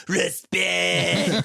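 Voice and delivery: loud high shouted respect; Booker T shout. Retold or imitated. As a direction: bark it out from the background. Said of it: "you hear Booker T go".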